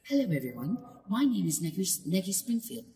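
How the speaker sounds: put-on high, whispery little-boy voice; childlike and simple, pitched well up; Negi. Shown as a demonstration. Shy boy greets his class as their new teacher, whispery childlike voice. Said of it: "his voice more sounded just whispery and childlike".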